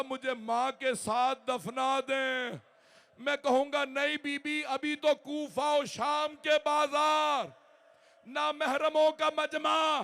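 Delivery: mourning recital swelling into a cry; rising wailing elegy. Acted. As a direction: build the mourning to a full-force wail.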